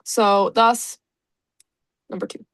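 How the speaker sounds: normal talking voice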